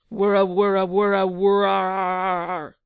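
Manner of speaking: loud, drawn-out roaring growl; lion roar. A narrator's reading. Lions devouring the Count, loud roaring.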